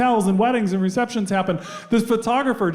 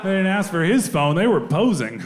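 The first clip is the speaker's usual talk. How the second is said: ordinary delivery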